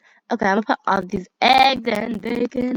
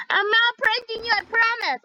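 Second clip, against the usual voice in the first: innocent; 12 semitones higher than usual. Pretend.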